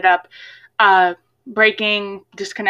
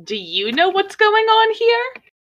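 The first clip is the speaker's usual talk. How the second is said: much higher, light questioning character; 12 semitones higher than usual. Acted. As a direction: ask it in Genya's high voice. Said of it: "Genya goes".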